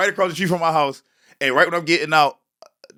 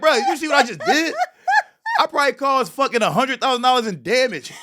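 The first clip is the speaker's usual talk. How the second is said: high, disbelieving, half-laughing; mock outrage at the cops, pitched up; incredulous rant; 9 semitones higher than usual. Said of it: "It's like".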